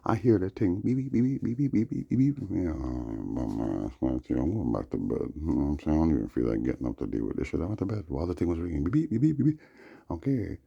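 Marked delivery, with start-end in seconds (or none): 0.8-2.4 high, quick mimicked beeping; sharp mock smoke detector beeps; alarm beeps. 8.8-9.6 high, quick mimicked beeping; sharp mock smoke detector beeps; alarm beeps. Imitating alarm again.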